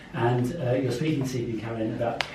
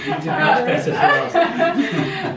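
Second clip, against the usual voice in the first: laughing; 7 dB louder, slower than usual. Real, not acted. Laughing reply to the joke.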